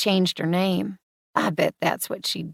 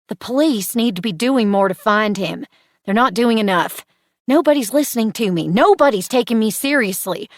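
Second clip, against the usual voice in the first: upset; 3 semitones higher, 6 dB louder than usual. Acted. Upset plea about police.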